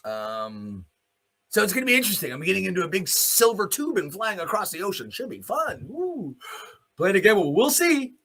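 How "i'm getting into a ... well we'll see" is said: normal talking voice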